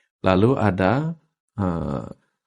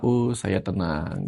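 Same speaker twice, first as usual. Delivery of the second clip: slow, soft, settled "saya tenang"; calm self-talk; 2 semitones lower, 5 dB quieter, much slower than usual. Shown as a demonstration. Guest shows the host calm self-affirmation, slow and soft. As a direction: breathe out and say it calmly to yourself. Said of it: "Jadi tarik rasa".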